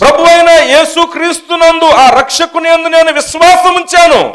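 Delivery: loud, high-pitched sermon delivery; full-force preaching. Real, not acted. Interpreter voices a preacher's testimony, preaching at full force. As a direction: belt out the confession to the congregation.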